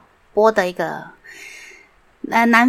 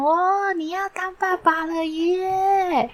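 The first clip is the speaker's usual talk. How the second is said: much higher, slowed, stretched-out wow of delight; excited amazement; 12 semitones higher, much slower than usual. Retold or imitated. Thinking of his friend becoming a father, high delighted wow. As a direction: gush with wonder at the news. 然后我就心想.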